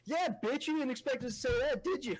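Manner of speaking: high mock gloat; gloating dad. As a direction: rub it in like the dad. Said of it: "My dad goes".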